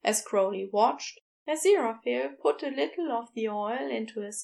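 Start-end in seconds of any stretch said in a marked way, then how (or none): none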